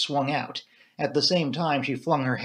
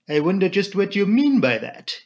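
put-on higher-pitched musing with a French accent; thoughtful Poirot; 6 semitones higher, 5 dB louder than usual. A narrator's reading. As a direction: wonder aloud as a Belgian detective. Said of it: "he said thoughtfully".

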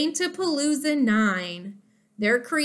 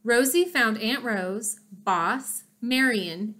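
usual speaking voice

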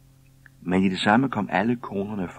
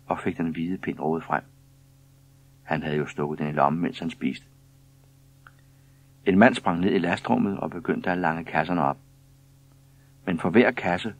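plain reading voice

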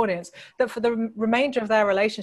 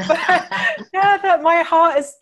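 much higher and louder through laughter; words bubbling out of a big laugh; 8 semitones higher, 8 dB louder, slower than usual. Real, not acted. Author laughing after joking about leaving the prize women, high and loud. Laughing at her own joke.

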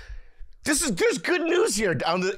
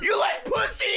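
joking shout. Real, not acted.

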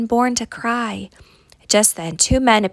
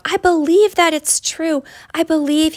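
higher, louder, eager outburst; the donkey declares his faith; bursting out; 6 semitones higher, 4 dB louder than usual. A narrator's reading. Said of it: "The donkey burst out".

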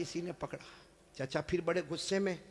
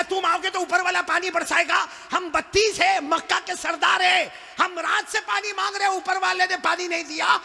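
high, loud angry scolding; furious uncle; 9 semitones higher, 9 dB louder than usual. Acted. Uncle angrily scolds the young nephew for interrupting. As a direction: scold him, voice raised high. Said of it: "चचा बड़े गुस्से हो गए".

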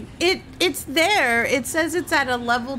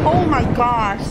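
sudden loud outburst; 7 dB louder than usual. Real, not acted. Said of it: "I just yelled at everybody".

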